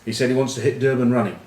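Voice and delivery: normal talking voice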